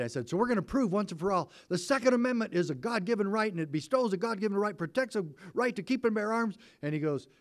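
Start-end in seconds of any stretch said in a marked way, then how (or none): 0.3-6.6 higher, fast, fired-up declaration; excited. Retold or imitated. Sheriff to the NRA lawyer, excited and fast. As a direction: rush it out, carried away with zeal. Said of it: "And that got me all excited".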